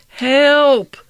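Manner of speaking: high, loud cry for help; shriek. A narrator's reading. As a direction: scream as you fall off the belt. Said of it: "shrieked Gift".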